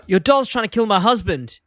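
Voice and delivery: high-pitched, mildly alarmed complaint; Marge. Acted. Wife complains to the doll hotline, mock alarm. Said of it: "she gets to the hotline and she goes".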